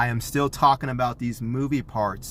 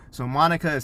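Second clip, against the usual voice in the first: usual speaking voice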